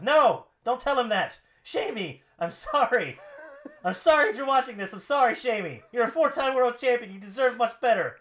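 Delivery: hammed-up wrestler dismay; distraught shouted apology. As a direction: beg forgiveness at full voice. Acting Rusev's apology to Sheamus.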